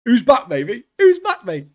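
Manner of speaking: high, loud excited cheer; cheering a hero's return; hyped. Real, not acted. Hyped about character returning.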